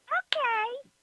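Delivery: squeaky high frightened okay; a tiny, cowed okay shot way up high; meek okay. Retold or imitated. Roommate caving to the threat in a squeaky okay. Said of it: "And he went".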